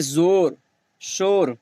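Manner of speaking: "शोर" on a plain o vowel; waw majhool. Shown as a demonstration. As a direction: open o in "जोर, शोर", not oo. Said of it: "खींच कर ना पढ़ा जाए".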